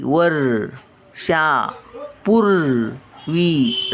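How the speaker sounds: वर्षापूर्वी at a crawl, each syllable held; very slow syllable reading. Shown as a demonstration. Grandmother sounding out वर्षापूर्वी for children, very slowly. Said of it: "word then slow repeat".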